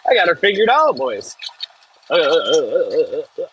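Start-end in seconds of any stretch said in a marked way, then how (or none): none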